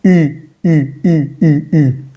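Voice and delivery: I rounded into French "ü"; I through U lips. Shown as a demonstration. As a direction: pucker for U but say I, repeatedly. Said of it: "pon boca de U, pero dices I".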